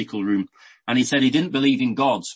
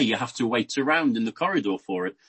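ordinary delivery

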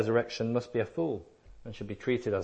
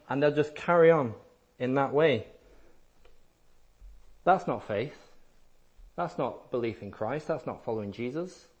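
ordinary talk, nothing marked

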